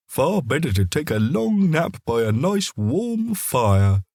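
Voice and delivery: a hint of a sleepy dog dreaming of the fire; dog's inner voice. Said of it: "he thought".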